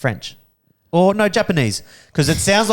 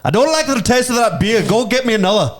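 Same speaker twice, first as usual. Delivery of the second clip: high, shaky attempt at an Irish accent; 7 semitones higher than usual. Shown as a demonstration. Host takes the challenge, in a high, uncertain Irish accent. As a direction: put on an Irish accent that slips towards German. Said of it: "do it in Irish".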